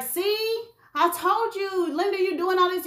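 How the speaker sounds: indignant husband. Acted. Husband scolding his wife for searching, high and indignant. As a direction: play him squeaky and wounded, insisting.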